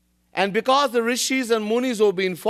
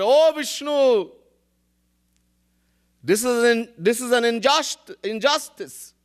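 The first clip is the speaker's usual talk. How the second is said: protesting demon; 5 semitones higher than usual. Acted. Slain Rakshas cries injustice to Vishnu, high protest. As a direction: wail the complaint, stumbling on the word.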